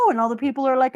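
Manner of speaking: normal talking voice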